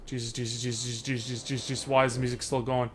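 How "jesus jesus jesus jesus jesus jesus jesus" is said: frightened muttering. Real, not acted. Fleeing the alien in fear.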